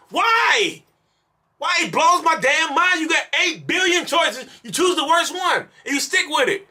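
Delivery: high, exasperated ranting at the viewer; frustrated rant. Real, not acted. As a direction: vent like you can't believe their choices. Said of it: "I'm frustrated as hell 'cause I'm angry".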